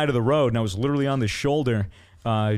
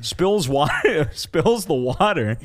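laughing; 4 semitones higher, slower than usual. Real, not acted. Retelling the jolt.

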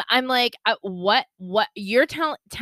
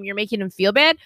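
ordinary talk, nothing marked

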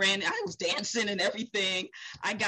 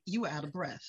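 quiet; 5 semitones lower, 7 dB quieter than usual. Retold or imitated. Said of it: "he was like".

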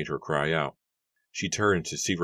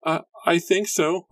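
ordinary delivery, nothing marked

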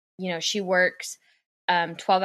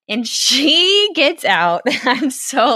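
getting angry; 6 semitones higher, 8 dB louder, much slower than usual. Real, not acted. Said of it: "I'm getting angry right now".

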